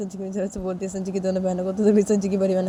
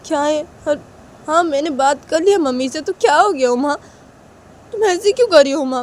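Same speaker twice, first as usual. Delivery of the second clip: put-on higher, pleading boy's voice; 6 semitones higher, 4 dB louder than usual. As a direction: beg her to talk to you again. Sanju pleading with Uma. (ऊँची आवाज़ में मिन्नत करता लड़का)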